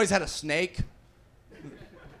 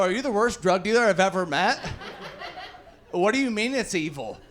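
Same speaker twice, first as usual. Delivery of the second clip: higher-pitched, indignant comic disbelief; incredulous; 3 semitones higher than usual. Acted. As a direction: challenge the dealer in mock outrage. Acted argument with drug dealer.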